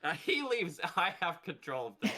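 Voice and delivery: high, loud mock game master. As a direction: play at being the GM, hammed-up. Taking over the GM seat.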